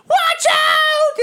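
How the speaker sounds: shout. Acted. Boulder flying at them.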